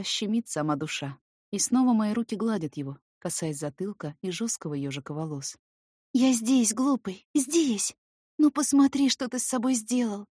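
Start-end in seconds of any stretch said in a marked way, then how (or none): 6.1-10.3 tearful tender scolding. Acted.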